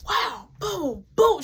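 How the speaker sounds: high, breathy imitated punch noises; mock punch sounds. Retelling a fight, imitating punches in a high voice. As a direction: boom it out like fight sound effects. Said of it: "And she was like".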